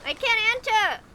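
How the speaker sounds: loud, high frustrated yell; frustrated shout. Real, not acted. Stuck, can't get in.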